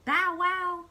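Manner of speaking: high, loud puppy bark; Clifford. Acted. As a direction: bark out like a dog. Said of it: "Clifford says".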